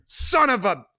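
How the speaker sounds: Sonny cursing as he leaves; cut-off curse. Acted. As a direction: storm off mid-word. Sonny storms off.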